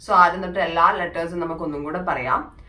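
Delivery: ordinary talk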